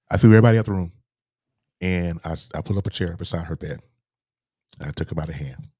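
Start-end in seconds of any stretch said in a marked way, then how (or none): 0.1-0.9 louder, pressing through emotion; emphatic louder retelling. Real, not acted. Son recalling clearing his mother's hospital room, louder with emotion. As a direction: push the words out louder, holding back tears. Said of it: "I'm not gonna get choked up".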